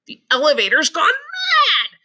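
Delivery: high, loud cry; shouted in alarm. A narrator's reading. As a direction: yell in fright. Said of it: "shouted Grandpa Joe".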